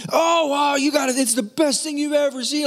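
very high, over-excited gushing; mock hype. Preacher showing what the pastor is not, high mock hype. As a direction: parody a gushing sensationalist. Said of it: "He's not one that hypes".

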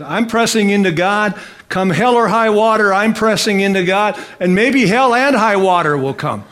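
loud, emphatic resolve; defiant declaration. Acted. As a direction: proclaim it like a vow to the whole congregation. Preacher voices a defiant vow.

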